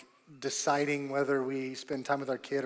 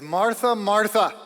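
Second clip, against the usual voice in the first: Martha, Martha called out higher and louder; emphatic call; 7 semitones higher, 13 dB louder, slower than usual. Retold or imitated. Jesus calling Martha to pay attention, loud and high. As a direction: call her name twice to grab her attention. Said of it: "he's emphasizing something".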